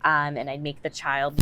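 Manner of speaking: usual speaking voice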